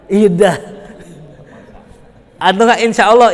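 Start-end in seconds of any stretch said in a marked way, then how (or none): 0.1-0.6 louder, curt, careless reply; louder grudging "Iya dah"; rude iya dah. Shown as a demonstration. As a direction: throw "Iya dah" away loudly like you can't be bothered. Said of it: "tuh nggak punya adab".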